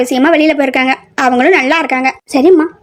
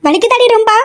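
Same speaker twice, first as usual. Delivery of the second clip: raised, anxious question to a hurt friend; worried concern; 7 semitones higher than usual. Acted. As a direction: ask with worry, pitched up. Asking hurt friend about pain. (கவலையோடு உயர்ந்த குரலில் விசாரிப்பு)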